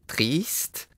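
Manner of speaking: light questioning insect voice; puzzled insect. A narrator's reading. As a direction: ask it innocently, rising. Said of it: "répondit le gentil insecte".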